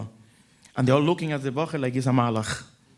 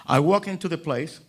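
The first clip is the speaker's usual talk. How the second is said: usual speaking voice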